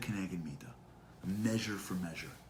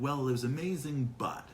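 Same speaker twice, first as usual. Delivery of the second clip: higher "amazing", then a dropped "but"; hedged praise; 4 semitones higher than usual. Acted. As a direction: praise it grudgingly, leading into a complaint. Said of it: "It's gonna be like".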